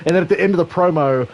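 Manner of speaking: usual speaking voice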